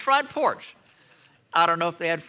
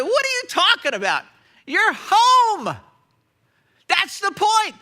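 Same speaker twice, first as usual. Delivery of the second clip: higher, louder, overjoyed welcome; joyful father; 12 semitones higher, 6 dB louder than usual. Retold or imitated. Father to returning son, joyful and loud. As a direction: burst out with a father's relief.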